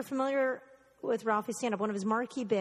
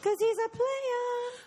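slow and much higher, a hammed-up pimp drawl; slower than usual. Talking up the boxer named Pimp, slow high pimp voice. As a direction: strut through it like a player. Voicing the pimp dog's swagger.

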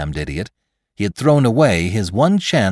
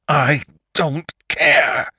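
higher, slow, strained cry; cried defiance; 8 semitones higher, much slower than usual. A narrator's reading. Attacker shuts out the argument, defiant cry. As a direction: cry it out word by stretched word. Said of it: "cried Lantry".

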